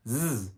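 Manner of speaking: zzz as a long buzzing voiced Z. Shown as a demonstration. Teacher shows the voiced Z sound. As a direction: hum the zzz with voice on. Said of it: "it sounds a Z sound".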